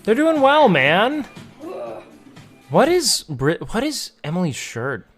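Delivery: normal talking voice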